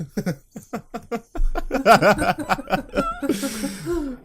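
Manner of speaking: high burst of laughter; high laughing fit. Real, not acted. Podcaster cracking up at his Jesse gag. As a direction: crack up at your own joke.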